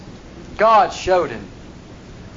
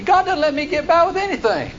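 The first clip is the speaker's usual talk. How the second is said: whining complaint; 9 semitones higher than usual. Acted. Asaph's bitter complaint voiced.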